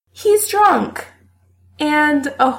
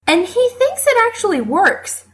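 ordinary delivery, nothing marked

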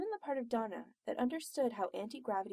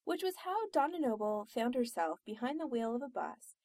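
ordinary delivery, nothing marked

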